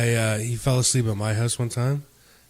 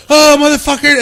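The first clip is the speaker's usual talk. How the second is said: high, loud imitated outburst; panicked swearing; 13 semitones higher, 10 dB louder than usual. Friend waking with covered glasses, panicked swearing. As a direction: yell it in mock panic. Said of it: "he started panicking".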